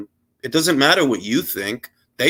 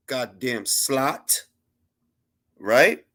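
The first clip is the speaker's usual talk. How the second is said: normal talking voice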